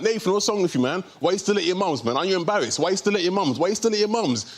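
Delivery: mock-badgering friends; mates teasing. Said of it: "every time they see me, they're like".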